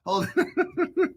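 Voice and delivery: high, laughing through the words; can barely get it out for laughing. Real, not acted. Still cracking up at the clip, high and laughing.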